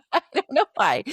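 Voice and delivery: giggling at her own quirk; laughing. Real, not acted. Host laughs about mason jars with the doctor, laughing. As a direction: laugh through it, high and loud.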